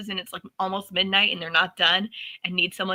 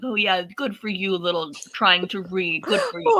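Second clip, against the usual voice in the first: condescending and pandering. Retold or imitated. Wife humoring husband's attempt to read, exaggerated condescension. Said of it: "condescending and pandering".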